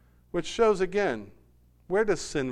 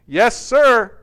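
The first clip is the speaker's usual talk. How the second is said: put-on loud, higher boy's reply to Dad; obedient boy; 7 semitones higher, 12 dB louder than usual. A narrator's reading. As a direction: answer up smartly like a son. Boy answers scolding father in story.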